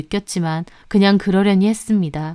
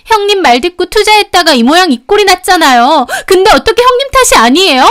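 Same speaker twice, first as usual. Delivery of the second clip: high, loud accusing outburst; angry yelling; 11 semitones higher, 9 dB louder than usual. A narrator's reading. Youngest sister-in-law insists the elder is at fault, angry yelling. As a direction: pin the blame in a high shout.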